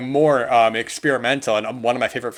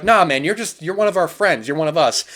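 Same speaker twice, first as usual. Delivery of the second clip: higher, louder buddy reassurance; friends reassuring; 6 semitones higher, 4 dB louder than usual. Retold or imitated. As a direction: welcome him in like a brother. Friends embrace Moose in movie.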